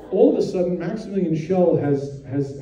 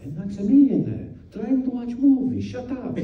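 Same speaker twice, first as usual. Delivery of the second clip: higher mock Italian hush-up; Italian scolding; 8 semitones higher than usual. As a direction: scold him in broken Italian English. Armani hushing Schell in screening.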